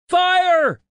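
high, loud shout of "fire"; yelled alarm. A narrator's reading. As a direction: shout a warning across the room. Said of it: "someone yelled behind us".

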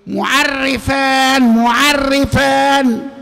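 معرفان drawn out very slowly, each syllable weighed; emphatic answer. Shown as a demonstration. Teacher stressing the key answer for students, slow. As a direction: lean hard on معرفان to drive it home. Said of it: "فلا يجتمع إيه؟".

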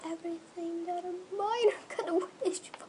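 laugh-crying through the words; tears of joy; choked up with happiness; happy crying. Real, not acted. Overwhelmed with joy.

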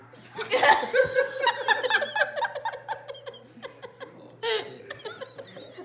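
long high helpless laughter; laughing fit. Real, not acted. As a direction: break up laughing.

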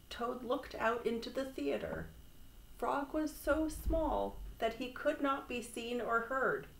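ordinary delivery

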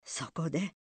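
ordinary delivery, nothing marked

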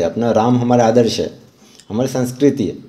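usual speaking voice

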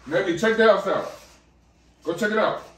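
high, loud, eager call to the dog. Real, not acted. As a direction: urge the dog on excitedly. Calling the dog to explore.

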